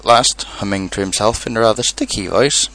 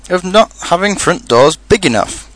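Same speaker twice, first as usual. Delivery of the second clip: high, mildly peeved grumble; cross; 8 semitones higher than usual. A narrator's reading. Pooh blaming Rabbit's front door, cross. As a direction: put the blame on someone else. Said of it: "said Pooh crossly".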